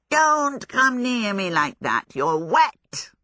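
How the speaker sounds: drawn-out hissing fire demon, starting high; hissed warning. A narrator's reading. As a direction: hiss it slowly, recoiling from the wet. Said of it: "he hissed".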